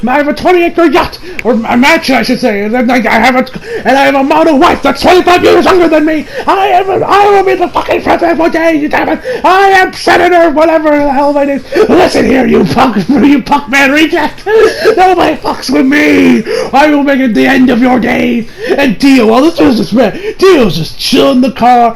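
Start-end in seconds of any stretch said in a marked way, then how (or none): 0.1-11.9 high-pitched, blustering mock brag; boastful flexing. Senator flexing his status at Dio, hammed-up boasting. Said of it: "he just starts flexing". 11.9-18.5 high, blustering mock tirade; mock angry threat. Senator threatening Dio, hammed-up angry bluster.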